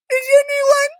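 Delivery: normal talking voice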